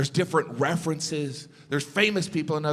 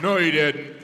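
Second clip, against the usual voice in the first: scoffing audience; 5 dB louder than usual. Retold or imitated. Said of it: "Half of you are like".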